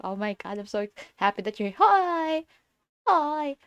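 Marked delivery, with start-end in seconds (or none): none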